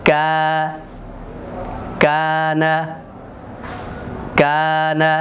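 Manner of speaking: কানা with a long, light front 'aa' in the first syllable; slow teaching demo of the light alif; thin alif. Shown as a demonstration. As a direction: hold কা level, thin and unrounded. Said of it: "চিকন হরফ হয়".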